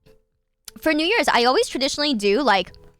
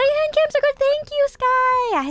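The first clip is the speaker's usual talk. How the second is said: squeaky high voice; slower than usual. Acted. High, slow thank-you voice.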